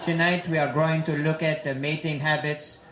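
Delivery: put-on slow, low French-accented documentary narrator; Jacques Cousteau. Explorer introducing a nature film on mating habits, French accent. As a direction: narrate gravely in a thick mock French accent. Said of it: "a creature Jacques Cousteau would find".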